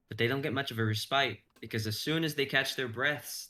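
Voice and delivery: normal talking voice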